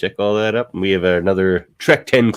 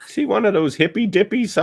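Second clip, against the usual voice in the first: very high-pitched granny voice; old lady; 14 semitones higher than usual. Pretend. Old lady asking her friend about long-haired boy, high and suspicious. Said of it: "Just a bunch of old ladies".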